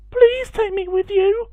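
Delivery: very high pleading goose voice; frightened goose. Acted. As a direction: beg like a scared little goose. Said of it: "a frightened quack".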